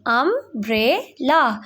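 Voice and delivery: umbrella drawn out slowly, um-brel-la, each syllable clear. Shown as a demonstration. Teacher showing students the syllables of umbrella, said slowly. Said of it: "learn the correct pronunciation".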